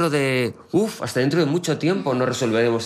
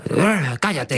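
mild mock shout, pitched up; half-hearted yell in a higher voice; mock yelling at radio; 5 semitones higher, much slower than usual. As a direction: imitate someone heckling the radio. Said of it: "¿Tú no le hablas nunca a gritos a la radio?".

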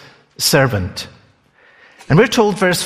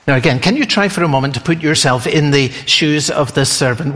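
usual speaking voice

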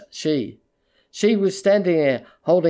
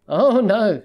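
higher, louder cry of protest; urgent, raised objection; alarmed protest; 5 semitones higher, 5 dB louder than usual. A narrator's reading. She protests as he tries leaving.

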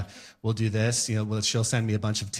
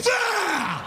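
put-on old man sneeze. Comic imitating his sudden sneeze, shouted. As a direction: fire the sneeze out of nowhere. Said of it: "There's no wind up anymore".

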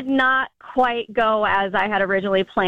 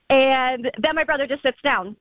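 ordinary delivery

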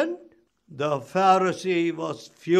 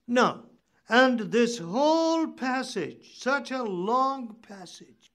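ordinary delivery

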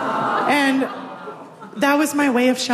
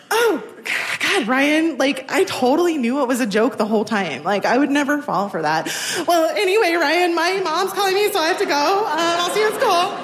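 higher, bright put-on casualness hiding hurt; breezy fake nonchalance; 6 semitones higher than usual. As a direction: brush it off like you never cared. Faking she saw through the joke.

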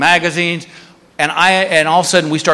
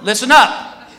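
raised, higher voice addressing the whole line; retold public announcement, pitched up; announcing to a crowd; 5 semitones higher than usual. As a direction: call everyone to attention like an officer. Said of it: "to this whole security line".

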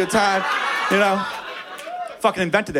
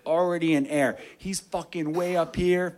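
ordinary talk

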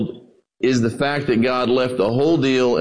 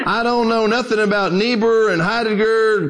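lightly voiced folksy old preacher, higher; a hint of a plain-spoken old preacher; 7 semitones higher than usual. Old preacher needling a scholar between bites, lightly acted. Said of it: "an old dark-skinned preacher".

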